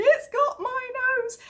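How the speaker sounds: put-on high tearful child; little boy wailing; crying toddler voice. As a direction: imitate a sobbing toddler.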